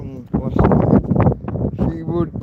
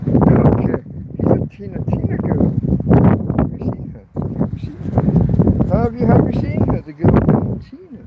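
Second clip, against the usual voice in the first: calling a pet; 9 semitones higher, 5 dB louder than usual. Real, not acted.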